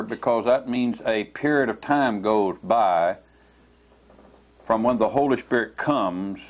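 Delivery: usual speaking voice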